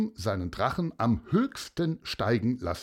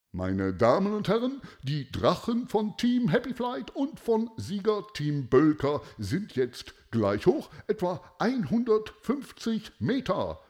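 contest commentator with a lifted pitch; loudspeaker announcer; 9 semitones higher than usual. A narrator's reading. Announcer reports both kites level, announcer voice. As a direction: announce the standings to a crowd. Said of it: "hörte man die Stimme aus dem Lautsprecher".

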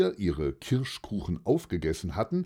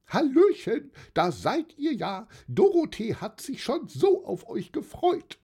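put-on high-pitched merry old lady hailing visitors; cheerful calling out. A narrator's reading. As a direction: call out a delighted, high welcome. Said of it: "die winkte und rief".

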